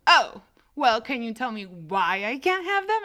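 flustered backpedal. Retold or imitated. Caught out, backpedals.

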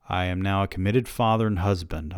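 ordinary delivery

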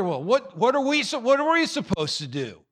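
high, loud, stammering bewilderment; mock panicked confusion; flustered panic. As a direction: stumble over it, rattled and helpless. Disciples' flustered reply to rebuke.

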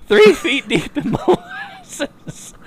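high, drawn-out squeal through laughter; laughing. Real, not acted. Host to co-host over the absurd molasses flood, laughing. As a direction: squeeze the words out through real laughter.

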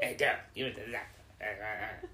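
slow, put-on puppet Doctor; little puppet voice. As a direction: draw it out as a silly puppet-man impression. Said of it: "You sound like that little puppet".

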